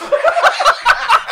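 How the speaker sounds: shrieking burst of laughter; high-pitched cackling laugh. Real, not acted. Cracking up at own story.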